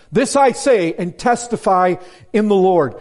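"This I say and testify in the Lord" high and loud; attention-grabbing declaration. Acted. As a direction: grab the congregation with "This I say and testify in the Lord". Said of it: "You wanna grab hold of them".

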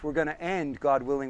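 usual speaking voice

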